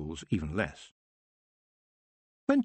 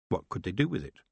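ordinary delivery, nothing marked

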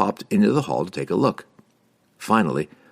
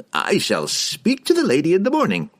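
high, fussy promise; assuring wizard; 11 semitones higher than usual. A narrator's reading. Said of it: "Harkle assured him".

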